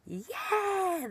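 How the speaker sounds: high mock kiddie cheer; excited kids. Kids told to touch the teacher, mock excited cheer. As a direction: cheer like a crowd of kindergartners. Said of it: "all the kids are like".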